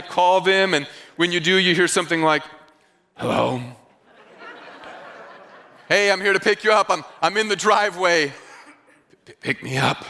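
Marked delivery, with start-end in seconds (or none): 3.2-3.6 mock drowsy phone answer; groggy hello. Sleepy friend answers phone. 5.9-8.3 fast, laughing through the words; cheerfully impatient, giggling; mock driver chuckling on phone; laughing caller. Driver calls friend from driveway. 9.2-10.0 low, quiet stammering half-asleep; confused like you just woke; mock drowsy stammer; groggy confusion. Sleepy friend confused on phone.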